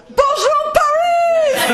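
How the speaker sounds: high, loud cry of Bonjour Paris; excited shout. Pretend. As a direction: belt it out like greeting a stadium crowd. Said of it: "I would love to say".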